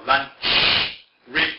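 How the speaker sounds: "lunch" and "rich" with the final ch pushed hard and loud; stressed final sound. Retold or imitated. Teacher mimicking David's loud, hard "lunch" and "rich". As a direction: lean hard on the ch at the end of "rich". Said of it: "listen to him when he says the C H".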